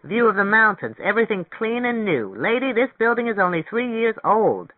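high, indignant rental agent; offended. A narrator's reading. Agent defends the building to the lady turning it down, offended.

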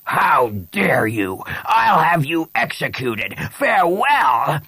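slow, high, blustering outrage; outraged. Acted. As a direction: threaten like an insulted overlord. Outraged lord threatens the priest.

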